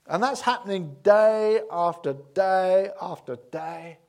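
each DAY stretched long and loud; emphatic repetition. Real, not acted. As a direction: lean hard on every "day", slowly. Stressing miracles never stopped.

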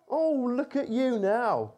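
passers-by jeering at the man on the cross; mocking jeer. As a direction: drag it out to gloat. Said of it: "they yelled at him".

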